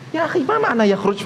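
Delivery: puzzled question in a high voice. Retold or imitated. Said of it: "Nggak nyambung mereka".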